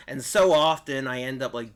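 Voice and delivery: ordinary talk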